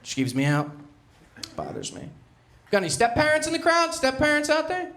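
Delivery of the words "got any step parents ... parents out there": high, loud crowd call. Real, not acted. Comedian asking the crowd for step parents, high and loud. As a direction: fish for hands in the room.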